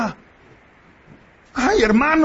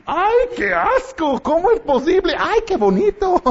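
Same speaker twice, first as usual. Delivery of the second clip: hammed-up disgusted wife turning delighted; mock wife; 9 semitones higher than usual. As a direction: squeal it like a disgusted wife, then gush. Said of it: "Y mi esposa dice".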